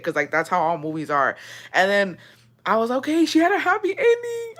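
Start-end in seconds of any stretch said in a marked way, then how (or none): 2.9-4.5 high-pitched retold reaction; squeaky re-enacted relief; relieved squeal. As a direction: say it way up high, acting out your relief. Retelling own relieved reaction to film.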